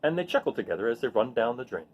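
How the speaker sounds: put-on gentle storybook reading voice for children; read-aloud narration. A narrator's reading. Teacher reading a rain picture book to her students, gentle storytelling voice. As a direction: read it like story time to a class.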